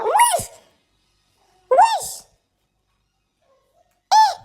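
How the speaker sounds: high startled shout; startled yell. Real, not acted. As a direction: yell out in sudden fright. Jump scare in horror game.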